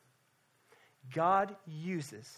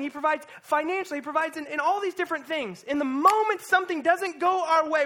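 high, loud, fervent preaching; preaching at full force; 9 semitones higher, 8 dB louder than usual. Real, not acted. Pastor urging the church, preaching at full force. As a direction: preach it hard to the room.

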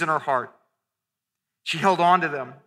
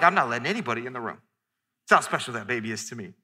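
quick, much softer and lower mock parent; hammed-up protective parent aside; protective new parent; 9 semitones lower, 6 dB quieter, much faster than usual. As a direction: rattle it off under your breath like a fiercely protective parent. Parent guarding newborn, quoted.

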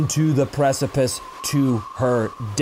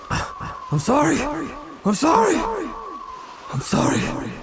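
anguished apology; 11 semitones higher than usual. Acted. Nightmare, repeated anguished apology.

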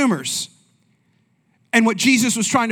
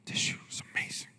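whispered in awe; 17 dB quieter, faster than usual. Retold or imitated. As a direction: breathe it like a secret. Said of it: "I'm like".

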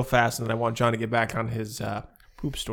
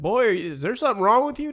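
Southern fisherman; 8 semitones higher, 5 dB louder, faster than usual. Retold or imitated. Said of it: "He's just like".

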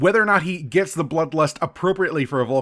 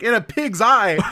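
scoffing McCoy; 7 semitones higher, 4 dB louder, slower than usual. Retold or imitated. McCoy scoffing as they walk away.

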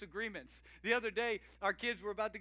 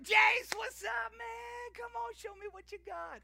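much higher, shouted hype-up call; excited greeting; 6 dB louder than usual. Retold or imitated. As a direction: pump up the little kid. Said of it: "I'm like".

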